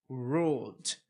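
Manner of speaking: "ruled" com d final, sem sílaba nova; ruled numa sílaba. Shown as a demonstration. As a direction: diga "ruled" curto, uma sílaba. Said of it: "É a pronúncia do E D". (ruled correto)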